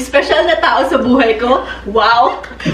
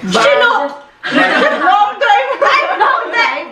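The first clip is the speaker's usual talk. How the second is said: excited; 7 semitones higher, slower than usual. Real, not acted. Excited answer.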